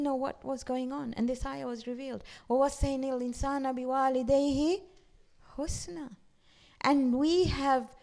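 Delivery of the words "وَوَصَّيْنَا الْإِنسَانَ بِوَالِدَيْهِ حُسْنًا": fast, flowing Arabic recitation; Quran recitation. Acted. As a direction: recite the verse in one breath.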